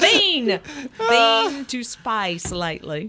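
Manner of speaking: slow corrective Australian accent, stressing "Been". Shown as a demonstration. Host correcting the engineer, slow Australian accent. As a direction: stretch "Been" the Aussie way.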